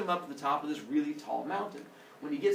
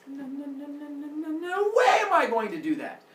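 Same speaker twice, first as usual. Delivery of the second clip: panicked refusal; 10 semitones higher, 4 dB louder than usual. Acted. Prometheus refuses Zeus.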